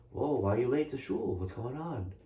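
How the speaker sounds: hushed, mocking "why are you late?"; mock judgmental jab. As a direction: tease the latecomer in a hushed, judging tone. Mocking latecomers at synagogue.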